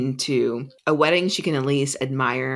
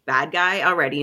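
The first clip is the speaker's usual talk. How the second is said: ordinary talk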